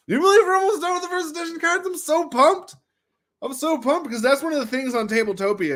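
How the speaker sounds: high, fast excited chatter; bubbling over with glee. Real, not acted. Telling chat he's nearly done, high fast excitement.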